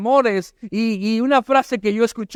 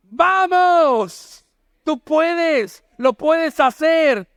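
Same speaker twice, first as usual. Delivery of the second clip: muy agudo y fuerte, el final lento; anímalo como un entrenador al borde de la cancha; coach motivador; 7 semitones higher, much slower than usual. Acted. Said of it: "ese coach para usted". (entrenador que grita ánimo, alto y agudo)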